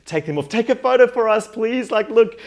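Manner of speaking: high, loud excited request; excited pleading. Retold or imitated. Retelling asking a stranger for a photo, excited shout. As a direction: call out high to a passer-by. Retold begging a passer-by for photo.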